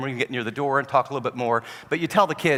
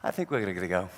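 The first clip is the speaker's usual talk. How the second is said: put-on calm kid; 8 semitones lower, 6 dB quieter than usual. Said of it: "the kid calmly looks at his friend".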